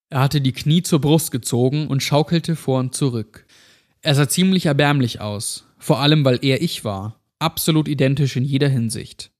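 plain reading voice

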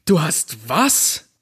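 langsam, fassungslos herausgeplatzt; ungläubig, gedehnt nachgefragt. A narrator's reading. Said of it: "platzte es aus mir heraus". (Jack ist fassungslos über Stephanie, langsam herausgeplatzt)

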